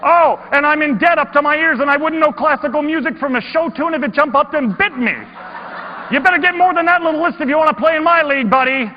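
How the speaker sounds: very loud, high, teasing challenge; loud gleeful boast, laughing near the end; yelling voice. Acted. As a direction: bellow it from the back of the room, one-upping. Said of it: "this voice yelling".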